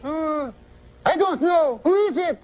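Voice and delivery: puzzled falsetto wife; high woman's voice. Acted. Wife gives up on uncle's riddle, high falsetto woman's voice. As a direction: go way up into comic falsetto. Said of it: "high voice".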